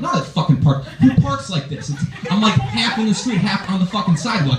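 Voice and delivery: high-pitched incredulous ranting; raged up. Retold or imitated. Driver arguing with parking officer over his car, mock raging. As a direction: protest in climbing, flustered disbelief. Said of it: "I'm, like, already raged up".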